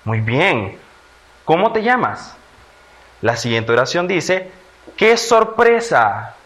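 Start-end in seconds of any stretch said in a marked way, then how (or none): 1.4-2.4 high question peaking on "te llamas", then falling; question intonation. Shown as a demonstration. Teacher repeats the sentence for children, a high-pitched question. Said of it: "utilizamos signos de interrogación". 5.0-6.3 loud, high, drawn-out surprise; exclamation of emotion. Shown as a demonstration. Teacher reads a sentence for children to classify, exclaiming in surprise. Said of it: "expresando una emoción".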